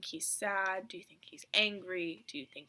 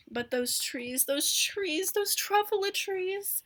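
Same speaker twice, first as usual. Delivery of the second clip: rising higher and louder with each repeat; building wonder; 11 semitones higher, 6 dB louder, slower than usual. A narrator's reading. Narrator first sees the truffula trees, building to excited wonder. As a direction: build from calm to amazed delight.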